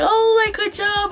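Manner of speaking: high-pitched, gushing congratulations; put-on cheery well-done; effusive praise. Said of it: "praising you and being like".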